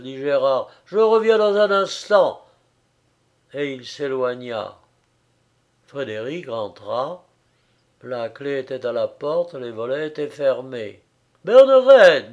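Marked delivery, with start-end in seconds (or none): 0.8-2.5 raised, much louder urgent voice; hurried promise. A narrator's reading. Friend dashes off as Frédéric goes up, urgent. 11.5-12.3 high, loud call of a name. A narrator's reading. Frédéric searches the shuttered house for her, calling out.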